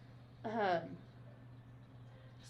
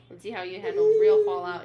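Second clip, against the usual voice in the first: angry; 11 semitones higher, 12 dB louder, faster than usual. Acted. Acted quarrel, she threatens him.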